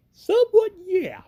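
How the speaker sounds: high-pitched admission. Acted. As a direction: pitch leaping up in a squeak. Hero voiced admitting his plan.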